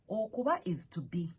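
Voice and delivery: ordinary delivery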